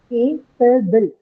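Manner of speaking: CAPABLE broken into three separate syllables. Shown as a demonstration. Teacher counting syllables for students, "Capable" split syllable by syllable. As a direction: say "Capable" slowly in three distinct beats. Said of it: "How many syllables? Three".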